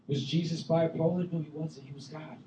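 ordinary talk

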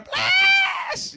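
high, loud "Blast!" shout; Southern church blast. Shown as a demonstration. Preacher shows the congregation a Southern blast shout. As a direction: let it rip like a Southern congregation. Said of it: "your best impression of a Southern blast".

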